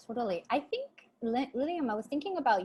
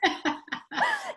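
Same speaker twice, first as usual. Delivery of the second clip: high burst of laughter. Real, not acted. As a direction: laugh hard at the memory. Laughing at son's reaction.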